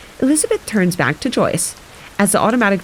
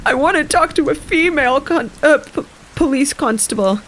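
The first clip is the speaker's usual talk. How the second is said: high tearful insistence; 8 semitones higher than usual. Acted. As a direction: demand it through fake tears, high. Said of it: "cheeks wet with tears now".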